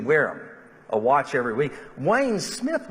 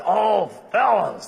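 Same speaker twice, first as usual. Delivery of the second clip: higher, louder and slow, delighted; happy greeting; 7 semitones higher, 5 dB louder, slower than usual. Retold or imitated. Wayne welcoming friends who bring dinner, delighted.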